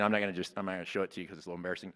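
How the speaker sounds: low, soft and hurried, embarrassed; sheepish aside. Real, not acted. Admitting a tattoo to the congregation, sheepish. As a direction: rush through it quietly, a little embarrassed.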